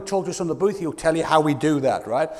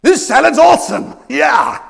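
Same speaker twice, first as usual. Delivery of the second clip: loud, high over-excited American; hammed-up American enthusiasm, shouted yeah; mock American gushing; 6 semitones higher, 10 dB louder than usual. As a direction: gush over a salad like an American. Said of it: "The American says".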